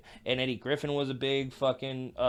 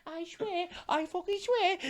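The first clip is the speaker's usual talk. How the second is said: high, softer mock-dad voice; a pitched-up caricature of dad; dad's voice; 6 dB quieter than usual. Shown as a demonstration. Son imitating dad from sleep talk, high mock voice. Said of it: "I do my dad's voice in my sleep".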